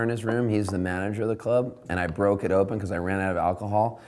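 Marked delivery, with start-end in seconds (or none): none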